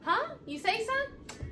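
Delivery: very high-pitched mock innocence; lilting imitation of a clueless daughter; imitated oblivious kid. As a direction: play the child who heard nothing. Imitates daughter who ignored her calls.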